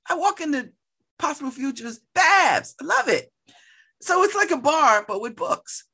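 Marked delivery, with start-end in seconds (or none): 2.1-2.8 cheerful, higher, louder name call; calling out a greeting. Retold or imitated. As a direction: hail him across the bar. Bookstore regulars greeting her.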